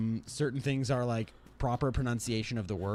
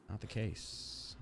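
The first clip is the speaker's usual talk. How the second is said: quiet mutter; 8 dB quieter, much slower than usual. Real, not acted.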